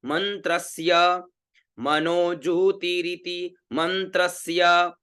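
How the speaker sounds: मंत्रस्य with a half म and a half स, said slowly; slow careful pronunciation. Shown as a demonstration. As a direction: sound out मनोज्योतिरिति मंत्रस्य syllable by syllable. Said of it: "उच्चारण क्या हुआ?".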